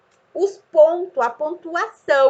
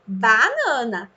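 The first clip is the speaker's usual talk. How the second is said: BANANA slow but unbroken; repeated slow dictation; slower than usual. Shown as a demonstration. Teacher keeps repeating for the child, BANANA slowly. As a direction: stretch BANANA as one joined word. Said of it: "E fica repetindo".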